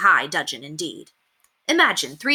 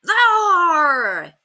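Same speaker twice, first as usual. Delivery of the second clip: 1 pirate cry; 11 semitones higher, 6 dB louder than usual. A narrator's reading. Pirate pointing cutlass.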